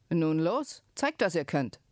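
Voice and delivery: plain reading voice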